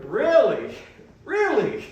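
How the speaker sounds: a hint of mock outrage; mock disbelief. Retold reaction to wife's fart.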